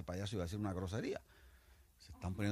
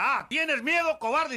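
very high, loud mocking yell; scornful bellowed taunt; taunting shout; 11 dB louder, slower than usual. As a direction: goad him as a coward. General taunts soldier.

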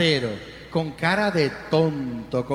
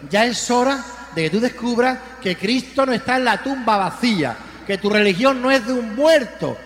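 full-force preaching; 4 semitones higher than usual. Acted. Priest urging the faithful, rousing full-force preaching. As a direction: drive the victory home line by line.

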